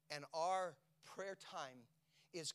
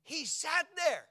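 preaching at full force; 13 semitones higher, 15 dB louder than usual. Acted. Pastor driving home Peter's waiting, preaching at full force. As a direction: preach it out to the whole room.

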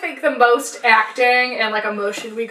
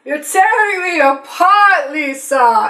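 slow tired quote; 4 semitones higher, slower than usual. Retold or imitated. Mocking Tommy's famous line, slow and listless. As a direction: drag the line out with no punch. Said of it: "but it's more like".

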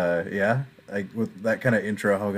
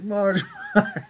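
panicked Bart; 6 semitones higher than usual. Retold or imitated. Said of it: "and he's like".

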